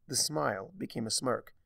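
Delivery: ordinary delivery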